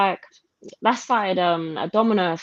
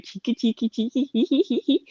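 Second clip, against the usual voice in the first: mimicked giggle; 4 semitones higher, 4 dB quieter than usual. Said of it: "she was laughing".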